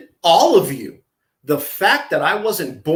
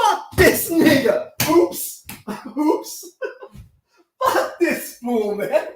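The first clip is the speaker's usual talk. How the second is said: laughing; 10 semitones higher, slower than usual. Real, not acted. Laughing at the video.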